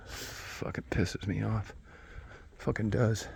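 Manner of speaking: soft, slow, drawn-out grumble; subdued grumble. Real, not acted. Man grumbling to himself after calm-mad swings, subdued.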